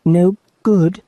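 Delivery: put-on high, startled girl's question; a frightened little girl. A narrator's reading. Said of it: "feeling rather frightened".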